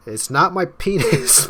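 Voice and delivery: high, loud, drawn-out protest; hammed-up indignant denial; indignant outburst. Acted indignant denial in quarrel.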